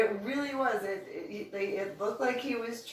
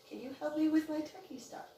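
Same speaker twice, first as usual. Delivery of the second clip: high, innocent asking; high, guileless plea; naive sweet request; 9 semitones higher, 4 dB quieter than usual. Retold or imitated. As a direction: sound like a hopeful tourist.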